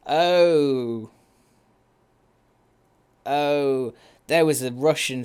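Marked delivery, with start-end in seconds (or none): none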